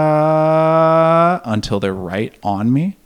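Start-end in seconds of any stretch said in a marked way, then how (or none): 0.1-1.4 long high loud mock scream; a held scream getting closer; sustained mock yell; imitated scream. Imitating distant screaming crowd.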